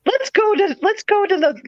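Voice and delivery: high, bright coaxing to a patient; high sing-song coaxing; cheery nurse voice. Retold or imitated. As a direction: coax her like a chirpy student nurse. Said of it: "I'm like".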